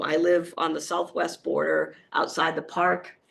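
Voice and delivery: ordinary talk, nothing marked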